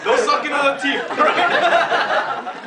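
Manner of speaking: high, loud words through laughter; real laughing. Real, not acted. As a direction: crack up while talking. Teller cracks up mid-story.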